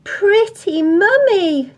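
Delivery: put-on high, loud, slow children's wonder; gasped admiration. A narrator's reading. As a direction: draw it out like awestruck children. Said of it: "gasped the children".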